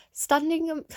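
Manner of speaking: loud, high burst of a shout; sudden shout. Real, not acted. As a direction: blurt the words out loudly. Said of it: "I don't know why I shouted that".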